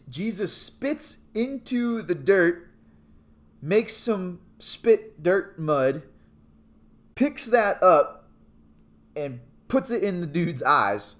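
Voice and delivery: drawn out with pauses between steps; slow, emphatic telling. Real, not acted. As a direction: spell out each odd action slowly. Slow step-by-step telling of odd miracle.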